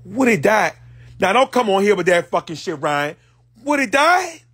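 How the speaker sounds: loud, high disbelieving cry; shocked outburst. Acted. Hearing Woody died, loud shocked disbelief. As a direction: react as if the news just hit. Reacting to news of death.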